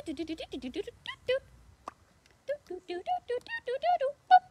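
high sung do-do typing sounds; typing noises. Pretend. Librarian miming a computer, high typing noises. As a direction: pretend to type, singing it.

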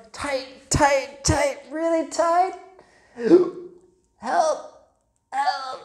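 high, strained calls for help; squeezed cry for help. A narrator's reading. Boy Stephen squeezed by the bear, high panicked help. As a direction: squeak out help as you're crushed.

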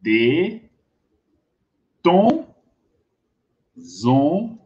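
"De, tom, zon" as isolated syllables with long pauses; syllable-by-syllable model. Shown as a demonstration. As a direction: say "De, tom, zon" one sound at a time, waiting between them. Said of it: "Vous prononcez comme ça". (« De, tom, zon » syllabe par syllabe, avec de longues pauses)

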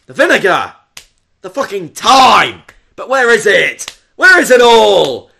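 worked-up, punchy shouting about the food; emotional chef rant. Pretend. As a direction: rant like a chef at the end of his tether. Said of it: "Sorry, I get so emotional".

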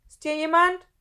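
loud, high, demanding call; forceful, strict calling out; stern shout. Acted. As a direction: challenge whoever is hiding. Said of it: "fragte eine strenge Stimme".

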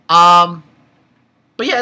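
ordinary talk, nothing marked

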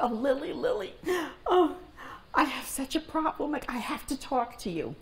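high, pleading retold cry for help; mock tearful, high-pitched plea; imitated tearful distress. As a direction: act out a distraught girl appealing to a neighbor. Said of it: "Evelyn is crying to beat the band".

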